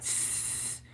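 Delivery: soft unvoiced sprinkler hiss; imitated hiss. Retelling the night sprinkler noise, soft hiss. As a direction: breathe out a quiet tss like a sprinkler. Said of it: "I heard hissing like".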